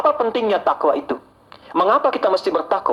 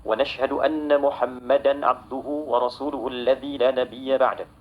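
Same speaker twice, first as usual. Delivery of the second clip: low, soft, measured liturgical recitation; Arabic khutbah opening; 3 semitones lower, 4 dB quieter, faster than usual. Real, not acted. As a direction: recite the opening praise in an even, subdued tone.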